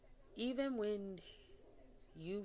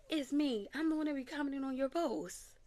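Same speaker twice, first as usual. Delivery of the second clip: excited lady; 9 semitones higher, 8 dB louder than usual. Retold or imitated. Voicing the lady in the hallway.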